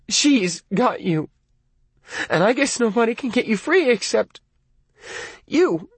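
pleading near tears; tearful, wavering. Acted. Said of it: "her voice was wavering toward tears".

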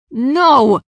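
loud, high cry of refusal; defiant shout. A narrator's reading. Raya refusing after a blast of magic, defiant shout. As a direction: shout it out in one drawn burst. Thrown against wall, refusing.

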